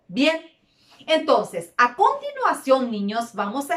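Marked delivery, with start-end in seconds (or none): none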